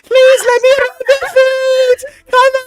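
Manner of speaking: high squeaky pleading voice; Mort voice. Acted. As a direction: beg in a squeaky little lemur voice. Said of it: "Mort can do a King Julian impression".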